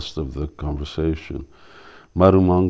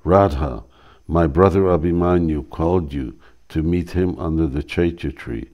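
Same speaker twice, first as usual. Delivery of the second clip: normal talking voice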